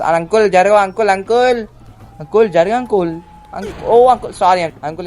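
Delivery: loud, high calls to move aside; shouting at uncle. Real, not acted. Driver yelling at the pedestrian uncle in the road, loud and high. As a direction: yell at him to get out of the way.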